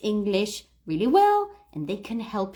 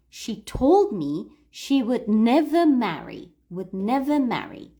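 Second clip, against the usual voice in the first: "told" and "never" punched, "marry" falling; stress on key words; 4 dB louder than usual. Shown as a demonstration. Teacher repeating the line one more time, stressing "told", "never", "marry". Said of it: "We put more emphasis on told, never, marry".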